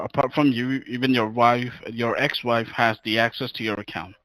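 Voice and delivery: ordinary talk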